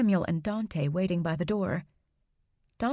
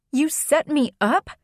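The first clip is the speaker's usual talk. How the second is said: angry; 7 semitones higher, 6 dB louder than usual. Acted. Outburst at betrayal.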